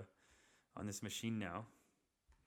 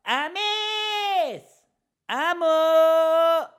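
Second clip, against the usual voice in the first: much higher, much louder calling out across the house; mom's high, loud call to come eat; calling out yelling; 13 dB louder, much slower than usual. Retold or imitated. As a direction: call him to the table from another room. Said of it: "Just starts yelling".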